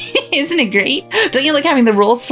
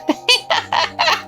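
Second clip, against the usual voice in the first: high, airy, breathy cackle; mocking witch laugh. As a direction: cackle down at her from above. Witch mocks the captive girl.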